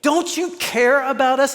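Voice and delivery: high, pleading accusation; desperate pleading cry. Retold or imitated. Disciples in the storm crying out at Jesus, high and pleading. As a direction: cry it out at him in panic. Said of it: "they were screaming".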